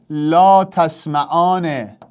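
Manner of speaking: «لا تسمعان» drawn out very slowly, syllable by syllable; slow dictation. Shown as a demonstration. Teacher dictating a verb for students to convert, very slowly. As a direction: spell it out slowly for the class. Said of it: "تو جلوتر از من اینو بساز".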